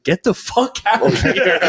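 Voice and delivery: high-pitched, drawn-out retold rebuff; mock angry dismissal. Door guy turning away a party crasher, mock angry. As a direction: throw them out, half laughing.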